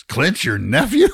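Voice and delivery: mock astonished; surprised bartender. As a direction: bartender suddenly impressed. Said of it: "And he goes".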